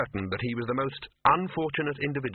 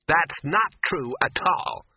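slow, louder, higher indignant snap; snapped denial; 5 semitones higher, 5 dB louder, much slower than usual. A narrator's reading. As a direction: cut him off, offended. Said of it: "snapped Ginger".

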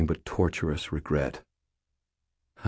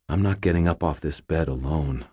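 plain reading voice

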